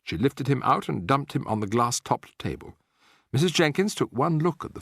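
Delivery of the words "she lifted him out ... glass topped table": plain reading voice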